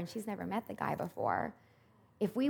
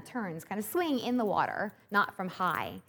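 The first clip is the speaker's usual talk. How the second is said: usual speaking voice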